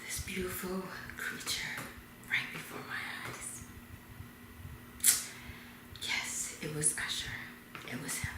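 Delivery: hushed, breathy reveal with long pauses; awed whisper. Acted. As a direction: whisper it in starstruck awe.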